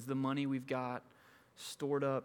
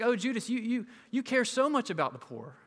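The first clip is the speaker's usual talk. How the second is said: much higher, louder gushing sarcasm; sarcastic mock praise; 9 semitones higher, 5 dB louder than usual. Preacher mocking Judas's fake concern, high gushing sarcasm. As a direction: gush fake admiration over him.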